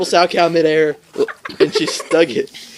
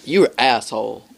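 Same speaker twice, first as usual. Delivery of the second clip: mom's jab; 5 semitones lower than usual. Retold or imitated. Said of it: "She was like".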